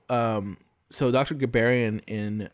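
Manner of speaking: ordinary talk, nothing marked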